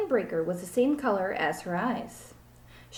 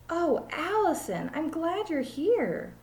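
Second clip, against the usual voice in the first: high, slow ditzy teacher voice; drawn-out, high and relieved; silly teacher; 8 semitones higher, slower than usual. Acted. Teacher greets Allison in the empty classroom, high and slow.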